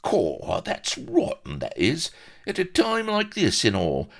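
very high, put-on dwarf whine; indignant dwarf. Dwarf pirate scolds captain for spoiling things, indignant whine. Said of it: "on indignant peg leg".

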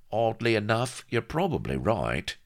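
lightly voiced pirate underling, a little high; a hint of rough sidekick. A narrator's reading. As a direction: concede it as the captain's crewman. Voices Vladimir in story.

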